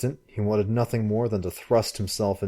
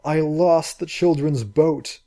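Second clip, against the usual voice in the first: wailing; 7 semitones higher, 4 dB louder, slower than usual. Acted. Girl weeping over lost boat.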